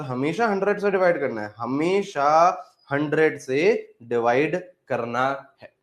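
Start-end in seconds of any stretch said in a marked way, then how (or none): 1.6-5.7 slow, word by word; slow dictation. Real, not acted. Teacher drilling the divide-by-hundred rule into students, slow dictation. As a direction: spell out the rule with spaced words.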